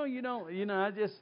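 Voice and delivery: usual speaking voice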